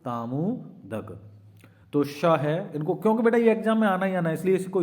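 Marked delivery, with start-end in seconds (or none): 0.0-1.2 तामुदक drawn out very slowly, syllable by syllable; slow mnemonic. Shown as a demonstration. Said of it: "क्या रखना है आपको". 3.0-4.8 urgent emphasis. Real, not acted. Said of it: "urging students, exam is coming".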